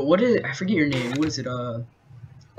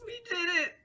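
victory cry; 11 semitones higher, 14 dB quieter than usual. Pretend.

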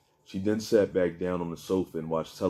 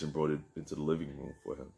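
ordinary delivery

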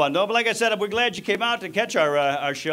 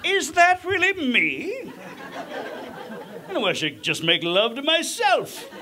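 much higher, very slow swooning at himself; vain muscle guy; 11 semitones higher, slower than usual. Retold or imitated. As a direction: fall in love with your own reflection. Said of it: "without going".

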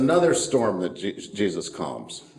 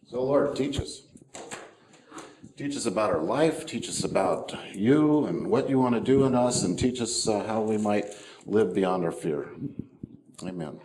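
softer, lower prayer voice, falling away to amen; 4 semitones lower, 3 dB quieter than usual. Real, not acted. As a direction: pray quietly on behalf of the congregation. Leads the congregation in prayer.

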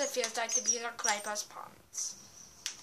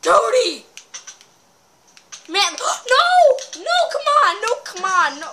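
loud, high shouts of dismay; anguished yelling; 10 semitones higher, 16 dB louder than usual. Real, not acted. Player watching his favorite die, shouting in despair. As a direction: cry out in despair. Teammate dies in game.

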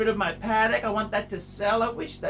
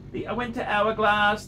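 ordinary talk

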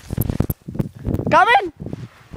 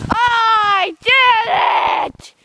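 triumphant shout; 7 semitones higher, 4 dB louder, much slower than usual. Real, not acted. Celebrating a run without falling.

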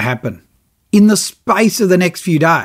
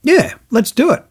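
keen agreement; 8 semitones higher than usual. Retold or imitated. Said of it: "So I went".

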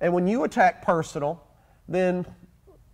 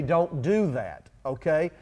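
ordinary delivery

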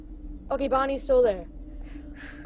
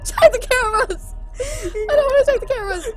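hysterical laughing panic; 11 semitones higher, faster than usual. Real, not acted. Terrified, refusing to check cameras.

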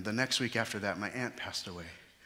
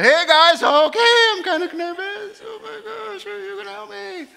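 very high squeaky panicked voice; mock nervous panic; 18 dB louder than usual. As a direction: squeal like a scared kid. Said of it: "went from calm to".

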